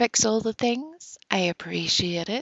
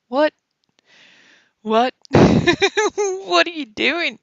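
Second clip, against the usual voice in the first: laughing disbelief; 9 semitones higher, 6 dB louder, much slower than usual. Real, not acted.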